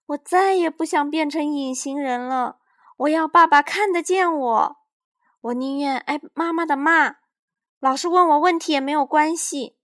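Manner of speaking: put-on high, loud distressed child pleading; boy lamenting being invisible; tearful complaint. A narrator's reading. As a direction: pour out the loneliness. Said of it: "他抱怨道".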